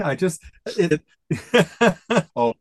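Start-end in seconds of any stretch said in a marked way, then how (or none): none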